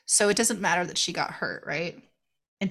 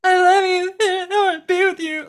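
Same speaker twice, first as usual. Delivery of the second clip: high, loud, hammed-up love confession; mock swooning lover; 10 semitones higher, 6 dB louder than usual. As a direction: overdo a gushing declaration. Mocking Josh at limo.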